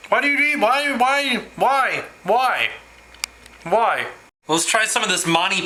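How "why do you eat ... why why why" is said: high repeated wails of "why", sinking each time; dismayed protest. Real, not acted. Vlogger reacts to a horrible taste of Vegemite, dismayed. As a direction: plead in dismay, starting high and falling.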